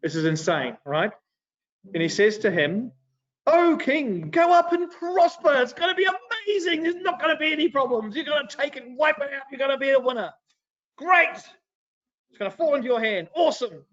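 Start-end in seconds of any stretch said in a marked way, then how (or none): 3.5-13.8 high-pitched, sing-out fake cheer; hammed-up, over-the-top sarcastic encouragement; mock-enthusiastic prophecy. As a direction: parrot good news with heavy irony. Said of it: "the most ironic thing".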